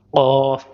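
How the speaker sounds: क़ाफ़ popped loud from deep in the throat; deep throat qaf. Shown as a demonstration. Teacher showing students a loud throaty क़ाफ़. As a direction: hit क़ाफ़ hard from the back of the throat. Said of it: "हलक से निकलता है".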